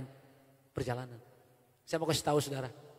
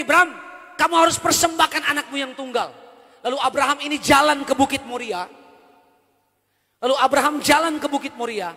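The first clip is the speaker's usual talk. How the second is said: raised, higher, louder preaching push; preaching at full force; 7 semitones higher, 10 dB louder than usual. Acted. Pastor preaching to the church, loud and higher. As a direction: drive the point home to the congregation.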